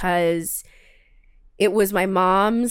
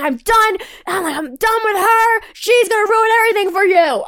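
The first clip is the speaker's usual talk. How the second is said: angry shout; 10 semitones higher, 6 dB louder than usual. Retold or imitated.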